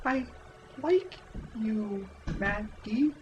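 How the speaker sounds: hammed-up falsetto of a swooning village girl; high-pitched girly voice. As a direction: squeal it out like a lovestruck girl. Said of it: "my high-pitched girly voice".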